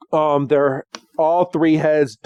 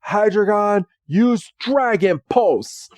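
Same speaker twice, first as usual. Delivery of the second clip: villain command; 6 semitones higher than usual. Retold or imitated. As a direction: call the attack like a smug hunter. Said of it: "this Pokemon Hunter says".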